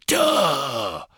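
loud bellowing cry; drunken roar. Acted. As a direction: bellow it at the stranger. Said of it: "brüllte er".

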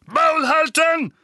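high, drawn-out furious shout; donnernd. A narrator's reading. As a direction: thunder the order at full force. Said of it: "donnerten Onkel Vernon und Tante Petunia". (gedehnt gebrüllter, wütender Befehl)

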